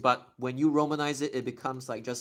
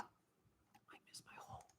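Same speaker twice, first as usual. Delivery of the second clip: soft unvoiced near-crying whisper; tearful whisper; 29 dB quieter, slower than usual. Real, not acted. Missing home in Japan, tearful whisper.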